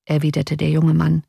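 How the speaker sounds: usual speaking voice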